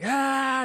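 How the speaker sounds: sharp high panicked yelp; panicked gasp. Retold or imitated. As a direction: yelp like you're slipping off the edge. Human option, panic on cliff.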